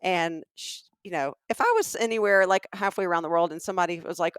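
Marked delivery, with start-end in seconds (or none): none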